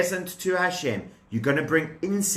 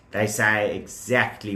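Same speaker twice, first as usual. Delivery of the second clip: ordinary talk, nothing marked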